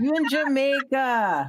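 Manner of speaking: slow, high island lilt; island lady. Retold or imitated. Island lady reminds tourists where they are, slow easygoing lilt. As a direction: draw it out like an unbothered islander. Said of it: "she was like".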